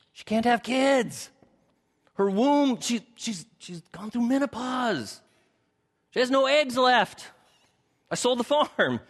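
high, hushed, awkward confession; sheepish Abram. Acted. Preacher as Abram awkwardly telling God his wife is too old. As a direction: say it delicately but with comic embarrassment.

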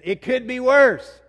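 shouted repeat. Shown as a demonstration. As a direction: belt "It could be worse" out slowly. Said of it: "Say it again".

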